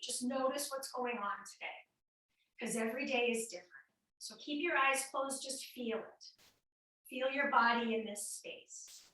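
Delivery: soft, calm breathing cues; guided meditation. Acted. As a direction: ease them in quietly. Leads breathing with closed eyes.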